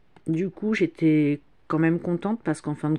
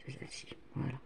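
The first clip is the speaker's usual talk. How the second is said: whispering; 2 semitones lower, 17 dB quieter, much faster than usual. Real, not acted. Adjusting the light, whispered.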